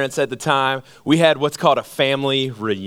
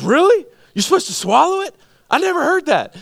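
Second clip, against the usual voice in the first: put-on much higher, incredulous boy; high surprised kid; 13 semitones higher than usual. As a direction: pitch it up like a startled kid. Cousin surprised at swallowing.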